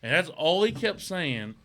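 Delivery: ordinary talk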